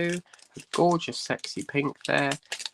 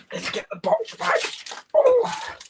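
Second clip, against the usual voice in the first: straining; 3 dB louder than usual. Real, not acted. Lifting a heavy box.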